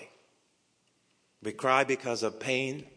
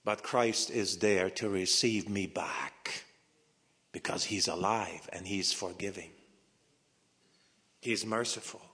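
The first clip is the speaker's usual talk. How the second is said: normal talking voice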